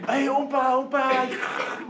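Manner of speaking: grandkids calling. Retold or imitated. Grandkids calling their grandfather Oompa in a high child voice. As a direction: chirp it like an eager small grandchild.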